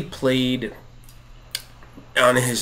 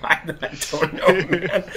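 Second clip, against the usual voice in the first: higher, slow words through laughter; laughing helplessly; 6 semitones higher than usual. Retold or imitated. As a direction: answer at a loss, cracking up. Laughing helplessly in retold reply.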